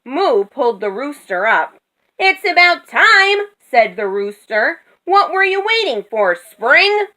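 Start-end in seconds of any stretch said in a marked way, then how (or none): 2.2-3.4 high, loud, indignant complaint; raised, high-pitched rooster grumbling; cross rooster. A narrator's reading. Cross rooster rescued from the roof. 5.0-7.1 high, sarcastic complaint; indignant, high-pitched rooster question; cross rooster. A narrator's reading. Cross rooster rescued from the roof.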